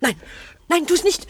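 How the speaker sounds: high, loud frightened cry; panicked shout. Acted. Frightened shout stopping a partner from going out. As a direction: grab them with your voice, stop them.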